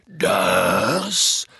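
loud, stretched-out word; Hagrid. A narrator's reading. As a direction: draw it out to present someone. Hagrid begins introduction to giant. (laut und gedehnt vorstellend)

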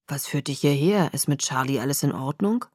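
low, soft voice of a dad; older father figure. A narrator's reading. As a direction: ask it quietly, pitched well down. Billy questions his visitor.

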